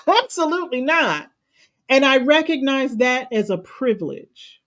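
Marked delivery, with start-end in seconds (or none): 0.1-1.3 higher, with a laugh on Absolutely; a chuckling no; laughing emphatic refusal. Real, not acted. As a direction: laugh it off firmly.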